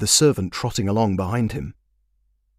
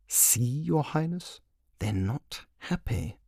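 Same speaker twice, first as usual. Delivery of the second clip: softer, slow aside from the corner of the mouth; out of the side of the mouth; 5 semitones higher, 9 dB quieter, slower than usual. Acted. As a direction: murmur it sideways so only the king hears. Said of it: "spoke sideways out of his mouth".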